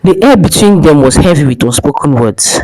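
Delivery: usual speaking voice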